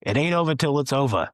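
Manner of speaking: put-on a playful nod to Yogi in a New York accent. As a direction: toss off a Yogi Berra line in a Jersey-New York accent. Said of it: "using my best, like, New Jersey slash New York accent".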